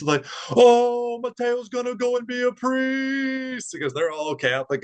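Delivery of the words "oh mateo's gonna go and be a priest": high, slow, thrilled exclamation; excited nurses. Retold or imitated. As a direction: gush over the news like delighted nurses. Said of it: "they're all super excited".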